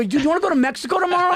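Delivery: put-on high, fast, overexcited drunk friend; drunk buddy voice. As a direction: blurt it out like a hammered buddy with a wild idea. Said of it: "I had one guy go".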